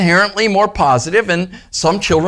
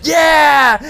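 excited yeah; 11 semitones higher, 5 dB louder than usual. Retold or imitated. Excited positive cheer.

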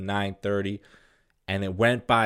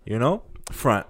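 ordinary talk, nothing marked

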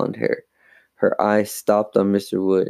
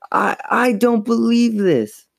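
high, slow, stammered dismay; stunned disbelief; 14 semitones higher, 3 dB louder than usual. A narrator's reading. Mother finds the kitchen a mess, stunned disbelief. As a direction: stumble into a high-pitched, drawn-out exclamation.

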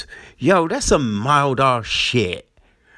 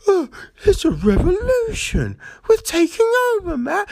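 high, fast mock-revolutionary voice; squeaky hammed-up rebel; mock rioter. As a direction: parody her giddy rebellion. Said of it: "And she's like".

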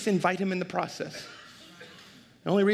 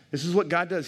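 ordinary talk

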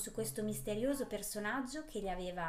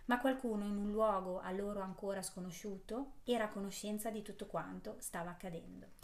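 ordinary delivery, nothing marked

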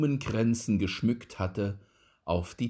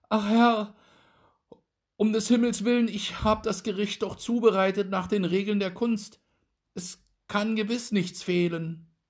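high, quick frightened dwarf; trembling plea; 12 semitones higher, 4 dB louder, faster than usual. A narrator's reading. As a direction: beg his master in a panic. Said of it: "So sprach der Zwerg und zitterte".

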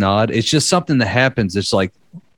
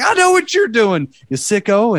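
put-on outraged girl; 10 semitones higher than usual. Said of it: "she's like".

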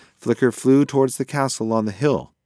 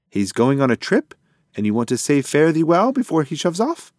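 higher, animated pigeon character; confident pigeon; 7 semitones higher, 5 dB louder than usual. A narrator's reading. Pigeon makes a wrong guess to his friend, confident and animated. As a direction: blurt the guess in a raised cartoon-bird voice.

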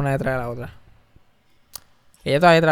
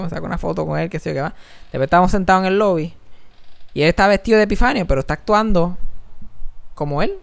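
ordinary delivery

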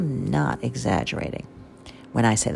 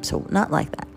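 ordinary talk, nothing marked

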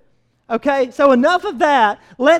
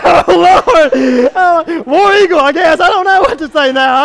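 loud, laughing flustered reply; 3 semitones higher, 7 dB louder than usual. Retold or imitated. Caught off guard by a rival fan, laughing loudly. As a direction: laugh through the confusion. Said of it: "I'm like".

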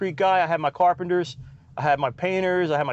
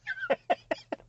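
high giggle; 7 dB quieter than usual. Real, not acted. After teasing the kids.

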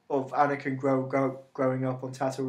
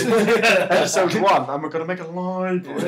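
pretentious; 4 semitones higher, 8 dB louder than usual. Retold or imitated. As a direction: ham it up like a pompous host. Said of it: "that sounds so pretentious".

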